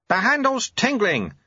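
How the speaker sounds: high, slow call; shouted. A narrator's reading. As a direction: call it out high, without full volume. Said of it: "shouted Lutze".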